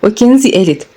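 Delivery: ordinary delivery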